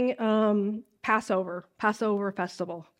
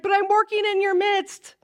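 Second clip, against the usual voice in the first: voicing Jesus; 7 semitones higher than usual. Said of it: "And he's like".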